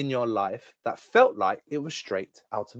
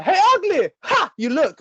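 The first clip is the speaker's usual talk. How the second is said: high, loud squawked jeer; hammed-up crow catcall; heckling crow; 13 semitones higher, 4 dB louder than usual. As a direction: shout taunts like a rude bird. Acting crows shouting insults.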